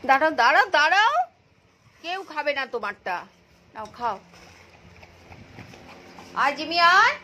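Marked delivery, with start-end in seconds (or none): 0.1-1.1 louder, higher stop call. Real, not acted. Owner calls out to stop her dog at the food bowl. 6.3-7.1 higher, louder come call; calling the dog. Real, not acted. Owner calls Jimmy over in a higher, louder voice.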